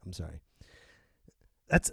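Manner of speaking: ordinary delivery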